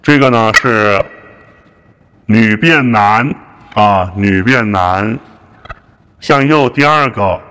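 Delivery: deepened changer voice; female-to-male voice changer. Shown as a demonstration. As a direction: voice run through a gender changer.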